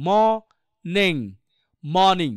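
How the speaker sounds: ম, নেং in separate pieces, then "mawning"; syllable-by-syllable, silent r. Shown as a demonstration. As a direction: build মর্নিং from "maw" and "ning", no r. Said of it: "তাহলে কি হবে?".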